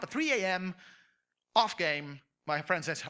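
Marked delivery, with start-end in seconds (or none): none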